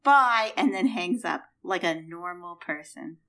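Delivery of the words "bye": one short loud bye; American goodbye. Retold or imitated. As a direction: say bye once and hang up. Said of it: "simply says".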